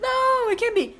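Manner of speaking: high-pitched imitation of incredulous people; mock disbelief. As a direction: squeal it as a doubter would. Imitates people refusing to believe her.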